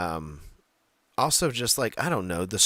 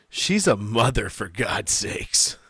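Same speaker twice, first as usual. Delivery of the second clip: mock exasperated mom; 5 dB louder, slower than usual. Mocking Becky's persona.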